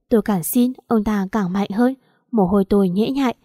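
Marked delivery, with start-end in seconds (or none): none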